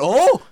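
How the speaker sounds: high, loud startled "oh"; retold yelp of shock on a rounded "oh".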